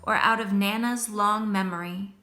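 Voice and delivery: put-on calm storybook reading for children; story narration. A narrator's reading. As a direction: read it like a teacher at story time. Reads picture book aloud.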